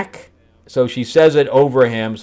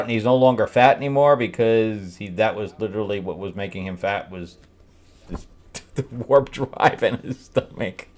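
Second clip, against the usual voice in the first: normal talking voice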